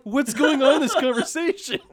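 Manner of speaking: high, laughing disbelief; laughing bafflement. Retold or imitated. Recalling the endless hi exchange, he asks through laughter.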